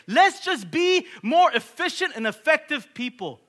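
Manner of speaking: high, loud fervent sermon; preaching at full force. Acted. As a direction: drive it home to the congregation. Preaching climax.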